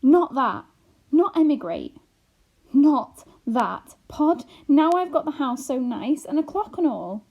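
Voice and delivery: loud, pleading protest; upset protest. A narrator's reading. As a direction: refuse it with rising alarm. Homily dreads emigrating.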